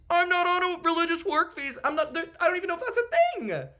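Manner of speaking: about to tear up, fighting it; close to tears. Real, not acted. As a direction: voice high and strained, near tears. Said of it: "he was about to tear up a little bit there".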